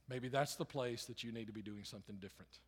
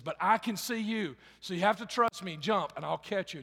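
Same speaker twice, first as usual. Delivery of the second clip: pleading shout; 6 semitones higher, 10 dB louder than usual. Acted. Father pleads with son.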